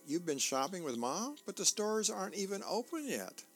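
rising disbelief as the daughter; surprised daughter. Acted.

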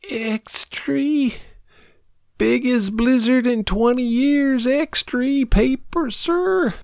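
put-on very high, faint child's cry of his papers; a half-asleep boy calling his papers; weary little newsboy. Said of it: "a weary little voice, scarce higher than a whisper".

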